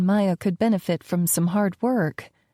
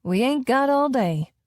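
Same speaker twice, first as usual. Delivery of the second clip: put-on southern accent; 5 semitones higher than usual. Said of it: "playing up my southern accent".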